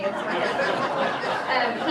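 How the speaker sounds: slow, amused laugh through the words. Real, not acted. Laughing about her husband's degree to the audience. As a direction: laugh it out slowly. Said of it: "laughing at own story".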